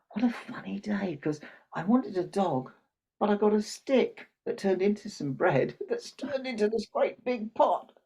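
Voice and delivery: put-on high-pitched boy thinking aloud; wondering little boy. Boy thinks over his funny day, amazed. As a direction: marvel in a high voice, stretching the last words.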